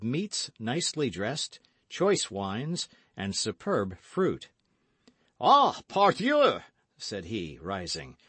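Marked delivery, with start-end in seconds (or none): 5.4-6.6 high, loud, drawn-out greeting; hearty exclamation. A narrator's reading. Porthos greeting friends at his table, hearty exclamation. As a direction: cry out in delighted welcome.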